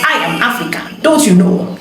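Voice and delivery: mock Hollywood-style African accent. Voice artist mocks how Hollywood films make Africans speak, mock African accent. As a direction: put on a movie version of an African accent. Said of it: "the African accent is borderline irritating".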